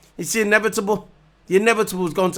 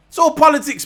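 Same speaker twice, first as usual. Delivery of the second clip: emphatic outburst; 9 semitones higher, 6 dB louder than usual. Real, not acted. Ranting about penalties on Hamilton.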